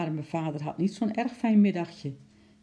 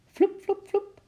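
leaping sound effect; 4 dB louder, slower than usual. A narrator's reading.

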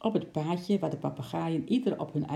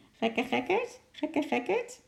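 high, squawking parrot voice; schreeuwende papegaai; 12 semitones higher than usual. A narrator's reading. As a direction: squawk it like a cheeky parrot, pitch shooting up at the end. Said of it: "de paar woorden schreeuwde". (hoge, krijsende papegaaienstem)

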